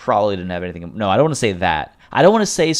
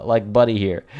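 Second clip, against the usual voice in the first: normal talking voice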